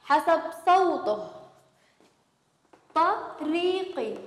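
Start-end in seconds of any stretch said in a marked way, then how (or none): 3.0-4.2 slow spelling again. Shown as a demonstration. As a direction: stretch each sound of طريقي for children. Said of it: "نلفظ كل حرف حسب صوته". (نطق ممدود وهادئ لكلمة طريقي)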